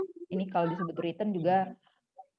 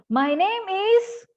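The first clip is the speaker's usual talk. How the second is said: "My name is" in a high slow chant, "is" swinging up; exaggerated high rise to "is"; schoolteacher's rising drill; 10 semitones higher, 4 dB louder, much slower than usual. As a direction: mimic a teacher, pitch way up on "is". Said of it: "ajarnya tuh gitu".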